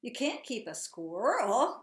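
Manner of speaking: loud, slow cry of warning; a grandfather's loud protest; cried out. Acted. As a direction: call out to stop her, drawn out. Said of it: "cried Granddad".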